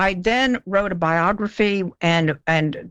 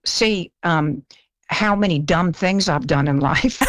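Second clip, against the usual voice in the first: ordinary talk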